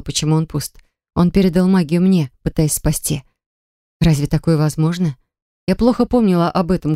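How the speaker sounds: plain reading voice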